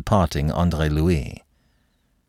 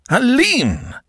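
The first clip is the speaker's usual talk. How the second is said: sharp call; 3 dB louder than usual. Acted. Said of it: "Her uncle's voice arrested her".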